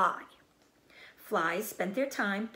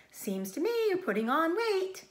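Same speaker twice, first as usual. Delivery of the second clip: high, teasing sheep voice; old sheep voice; 11 semitones higher, 3 dB louder than usual. Acted. Old sheep teasing Wilbur about weight, high-pitched. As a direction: poke fun like a nosy old ewe.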